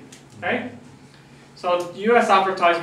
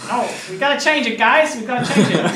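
laughing insistence; 5 semitones higher, 4 dB louder than usual. Retold or imitated. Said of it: "the U S guys say".

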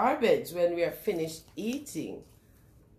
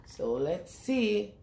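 ordinary delivery, nothing marked

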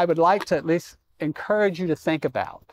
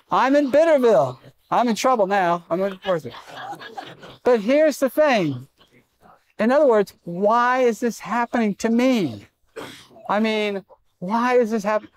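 louder and higher, driving the point home; full-force preaching; 6 semitones higher, 3 dB louder than usual. Acted. Pastor telling the congregation about his tooth, preaching at full force. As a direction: preach it out hard to the church.

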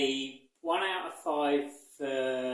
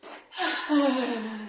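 long high sigh of resignation; weary sigh; 10 semitones higher, 7 dB louder than usual. Real, not acted. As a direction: sigh like the shops are a chore. Weary sigh about shopping.

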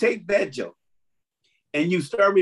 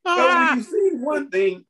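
laughing; 9 semitones higher, 3 dB louder than usual. Real, not acted. Laughing emphatic restart.

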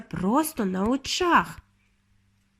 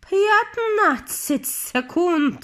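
very slow, higher witch call; 8 semitones higher, much slower than usual. A narrator's reading. Grand High Witch calls out the countdown to the witches, drawn out. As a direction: draw out each word gleefully. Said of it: "крикнула верховна відьма".

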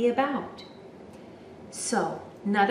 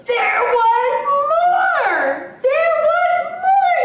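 high, loud drawn-out wail; big storybook shout, pitched way up; crying out; 8 dB louder, slower than usual. A narrator's reading. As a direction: cry it out slowly at full voice. Gerald cries out in the story.

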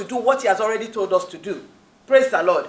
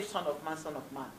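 hushed quote; 6 semitones lower, 16 dB quieter than usual. Retold or imitated. Quoting Jesus' repeated title.

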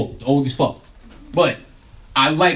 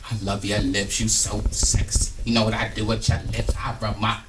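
flirting lady; 7 semitones lower, 8 dB quieter than usual. Acted. Lady flirting with the comedian, low suggestive voice.

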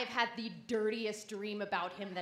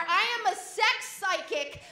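ordinary talk, nothing marked

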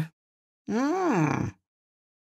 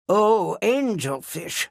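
usual speaking voice

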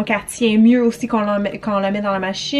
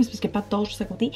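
speaking softer and quickly; lowered voice; 9 dB quieter, much faster than usual. Real, not acted. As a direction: keep the voice down. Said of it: "j'ai baissé le ton un peu".

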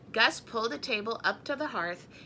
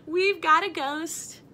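proud family voice; 7 semitones higher, 6 dB louder, slower than usual. A narrator's reading. Scotts quoted proudly.

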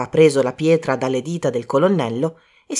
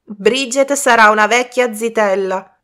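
loud, raised-pitch taunting chant; loud proclamation; 5 semitones higher, 5 dB louder than usual. Acted. Two boys tease Bridget about spinsterhood, loudly proclaiming. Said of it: "proclamarono a gran voce i due ragazzi in coro".

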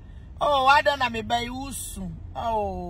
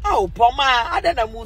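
ordinary delivery

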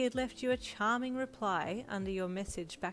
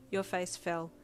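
ordinary delivery

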